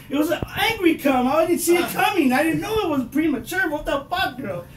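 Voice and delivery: normal talking voice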